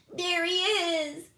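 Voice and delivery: high, slow delighted cry; squeaky aliens spotting help; relieved aliens. A narrator's reading. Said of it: "cried Bork and Gork".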